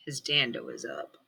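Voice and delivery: usual speaking voice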